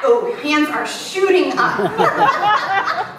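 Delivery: slow, amused, laughing through it. Real, not acted. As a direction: chuckle as the hands go up. Pastor sees hands raised, laughs.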